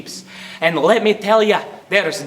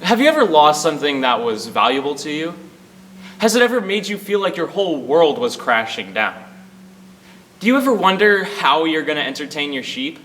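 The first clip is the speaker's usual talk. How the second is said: dramatic announcer; 7 semitones lower than usual. Acted. Mock dramatic announcer intro.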